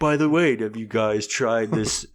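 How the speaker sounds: high, slow salesy voice; MLM sales pitch. Acted. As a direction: pitch it like a pushy MLM rep.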